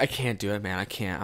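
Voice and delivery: low, hushed confession; nearly laughing aside. Retold or imitated. Friend confides in the bathroom he can't keep it up, hushed aside. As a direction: give up under your breath. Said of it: "I'm, like, almost laughing".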